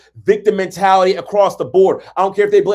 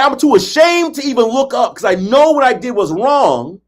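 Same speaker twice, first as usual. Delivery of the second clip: ashamed confession; 8 semitones higher, 4 dB louder than usual. Retold or imitated. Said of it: "He said".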